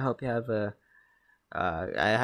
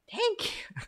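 squeaky high sarcastic thanks. Pretend. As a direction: thank them with mock gratitude. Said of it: "I'm being sarcastic".

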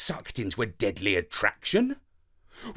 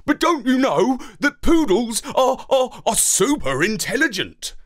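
higher, louder protest; insisting; 8 semitones higher, 4 dB louder than usual. A narrator's reading. Dog insisting to the parrot, higher and louder. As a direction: insist stumbling over the words. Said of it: "I insisted".

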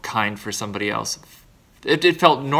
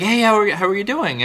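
cheery greeting; 9 semitones higher, 5 dB louder than usual. Retold or imitated. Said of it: "And I was like".

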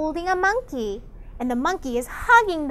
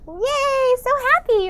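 high-pitched, delighted little cheer; happy cheer; 9 semitones higher than usual. Acted. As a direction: cheer along, pitched well up.

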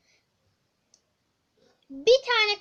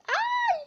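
high startled shriek; a sharp scared yelp; sudden yelp. Real, not acted. Ani korku çığlığı. (ani tiz çığlık)

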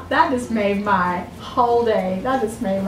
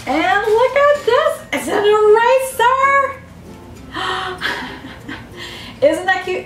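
delighted; 9 semitones higher than usual. Real, not acted. Showing a cute eraser.

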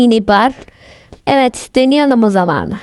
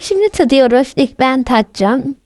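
ordinary talk

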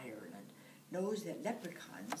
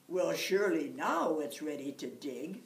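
louder, pleased with himself; proud; 8 dB louder than usual. Acted. Jamie shows off his huge potato, mildly proud and louder. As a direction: boast about the giant potato. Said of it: "Jamie said proudly".

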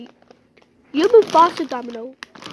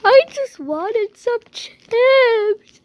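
slow, higher hammed-up wail; mock crying; 6 semitones higher, 6 dB louder, much slower than usual. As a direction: whine like a robbed child. The owner pretends to cry.

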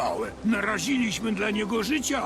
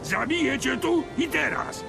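threatening shout; 8 semitones higher than usual. Acted. Threatens to kill.

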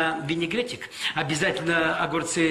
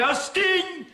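very high scolding cry; shrill mother's yell; 14 semitones higher, much slower than usual. Retold or imitated. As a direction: shriek it like an Odessa mother. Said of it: "Им кричали".

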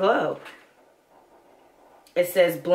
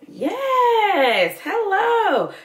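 high, sing-song yes and hello; excited cheer; 12 semitones higher, much slower than usual. Real, not acted. Hailing the lipstick, high and sing-song. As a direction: greet it like a long-lost friend.

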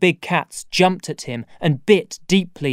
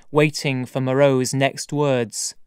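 ordinary delivery, nothing marked

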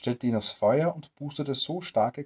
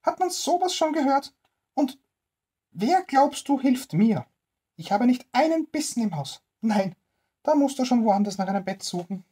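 put-on high, louder old woman voice sending him off; grumpy old woman; 13 semitones higher, 6 dB louder than usual. Stingy old woman refusing the tramp a bed, high and loud.

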